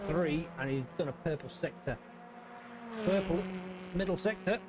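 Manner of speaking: race commentary. Acted.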